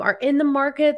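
usual speaking voice

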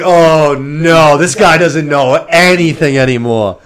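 put-on loud, high alarm at the old king; alarmed shout. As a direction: cry out that he's lost it. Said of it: "we're just like".

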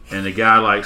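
ordinary talk

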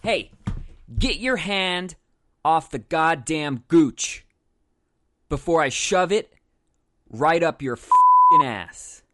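loud, high, slowly spaced-out threat; building to a loud, drawn-out shout; furious threat. Acted. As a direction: bellow it in slow chunks with pauses. Threat over grabbed Gucci phone.

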